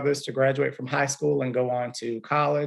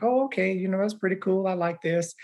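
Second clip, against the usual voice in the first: higher-pitched retelling of a dawning liking; retold pleased reaction; 6 semitones higher than usual. Teacher recalling his younger self's reaction, retold high-pitched. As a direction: quote yourself in a much higher voice, settling down. Quoting own youthful reaction.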